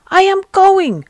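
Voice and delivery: healed man crying out he will go; triumphant cry. Acted. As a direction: declare it with sudden joyful strength. Paralyzed man rising to go.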